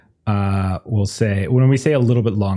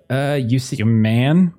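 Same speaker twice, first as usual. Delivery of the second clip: ordinary delivery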